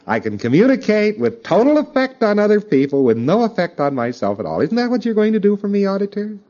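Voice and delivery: high, needy plea to the auditor; imitated whining plea to auditor; mock victim pleading. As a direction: beg like a smug victim. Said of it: "his victim valence".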